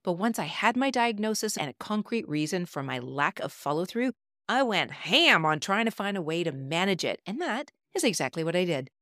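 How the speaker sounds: upbeat promo read. Acted. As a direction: pitch it like a radio spot. Recorded promo for her program.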